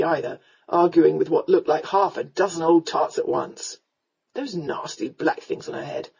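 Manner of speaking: put-on low, slurred drunkard's voice; drunken rogue, grumbling. A narrator's reading. Drunken rescuer's voice.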